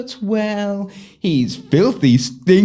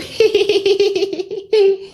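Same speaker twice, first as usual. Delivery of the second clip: giddy giggle; 12 semitones higher than usual. Acted. Smitten sister giggles about love.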